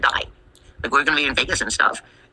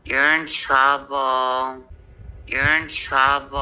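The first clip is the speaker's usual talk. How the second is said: ominous warning; much slower than usual. Acted. Slow ominous warning after long pause.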